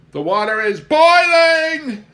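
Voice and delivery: very high, loud, slow shriek of amazement; thrilled, shrill-high yell across the yard; hysterical shout. A narrator's reading. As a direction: shriek it like a miracle just happened. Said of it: "shouting hysterically to Bob".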